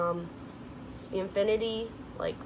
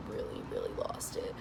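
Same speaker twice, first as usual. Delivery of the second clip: much softer, whispered confession; 12 dB quieter than usual. Real, not acted. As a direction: breathe it like a secret. Confessing she broke down.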